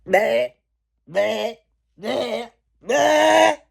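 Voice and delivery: high strangled bleats rising to a shout; hammed-up cries of a man shrinking; dramatic mock bleating. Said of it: "It's all dramatic".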